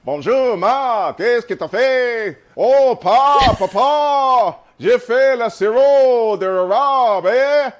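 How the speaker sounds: mock a rustic Quebecois family from a TV show; Quebecois TV accent. Shown as a demonstration. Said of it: "And they talk like this".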